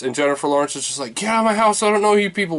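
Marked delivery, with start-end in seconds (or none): 1.1-2.6 mock outrage. Voicing Jennifer Lawrence kicking out guests.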